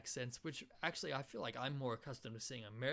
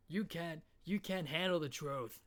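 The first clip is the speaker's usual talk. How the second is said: higher, emphatic American film outburst; movie quote impression; 5 semitones higher, 4 dB louder than usual. Comedian quoting a famous film, raised emphatic outburst. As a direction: declaim it like the famous courtroom line. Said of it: "quintessential movie quotes".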